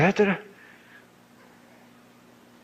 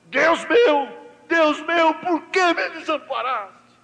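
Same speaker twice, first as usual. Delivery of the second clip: anguished cry; 10 semitones higher than usual. Retold or imitated. Jesus crying out to the Father, high anguished cry. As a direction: plead upward, the voice breaking away. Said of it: "O brado que houve lá foi".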